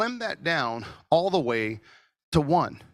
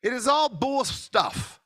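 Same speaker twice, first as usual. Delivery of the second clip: fired-up shout; 11 semitones higher, slower than usual. Real, not acted. Telling the audience fame is fake, fired-up shout. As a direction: hammer it home like a preacher.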